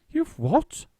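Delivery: high astonished question; surprised. A narrator's reading. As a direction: blurt it out in shock. Lady surprised.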